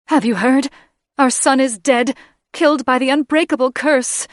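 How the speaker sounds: high, loud grief-stricken cry; anguished outcry. Acted. Mother announcing her son's death to the kings, high and loud. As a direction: throw the news at them in anguish.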